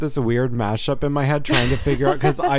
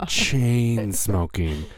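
slow emphasis; 2 semitones lower, much slower than usual. Real, not acted.

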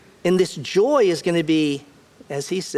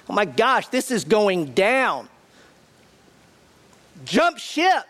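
higher, panicky disciple voice; mock panic; 4 semitones higher than usual. Disciples panicking as Jesus is arrested, higher mock panic. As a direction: play a frightened disciple ready to bolt. They're terrified.

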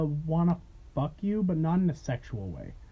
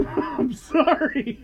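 laughing apology; 7 semitones higher, much slower than usual. Real, not acted.

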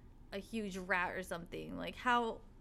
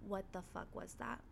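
soft, spooked question; retold hushed shock, voice dropped; hushed alarm; 3 semitones lower, 10 dB quieter than usual. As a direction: ask it under your breath, uneasy. Sister startled by roof noise.

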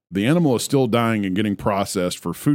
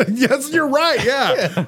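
high, laughing agreement; 13 semitones higher, much slower than usual. Real, not acted.

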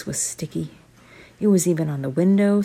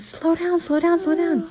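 calming parents; 11 semitones higher than usual. Acted. Parents calming hyper kids.

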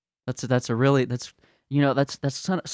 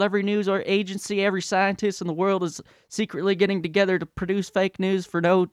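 put-on higher, louder, childish ranting denier; mock denier tantrum; 6 semitones higher, 5 dB louder than usual. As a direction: plug your ears and yell like a spoiled kid. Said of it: "they're like".

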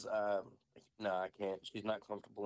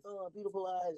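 lightly voiced gushing stranger, pitched higher; mimicked admirer; 8 semitones higher than usual. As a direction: coo it in a higher mock voice. Mimicking admirers of his daughter.

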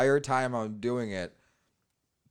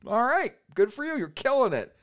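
impressed; 11 semitones higher than usual. Retold or imitated. Retold impressed reply to host.

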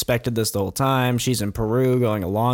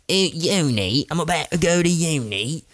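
higher, put-on voice of a schoolgirl; lightly mock the girl's voice about uni; a hammed-up girly voice; mock posh teen girl; 5 semitones higher than usual. Mocking the teen girl's excitement.

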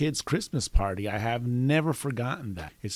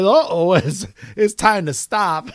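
laughing retelling; 6 semitones higher, 9 dB louder than usual. Retold or imitated. Quotes grandma while still cracking up.

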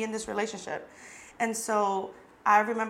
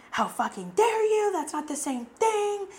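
mocking outraged boyfriend; 11 semitones higher than usual. Mimicking her boyfriend's outraged reply to her question, high and mocking. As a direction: ham up his indignant comeback.

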